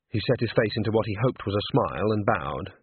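ordinary delivery, nothing marked

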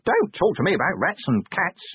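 high-pitched little imp, grumbling; high, aggrieved imp voice; complaining imp. Acted. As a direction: complain as a small put-upon creature. Imp complains about its journey.

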